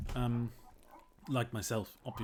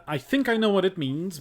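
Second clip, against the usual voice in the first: normal talking voice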